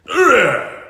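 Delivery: sharp loud high grunt of pain; a hit lands, grunt it out. Acted. Bane takes a punch.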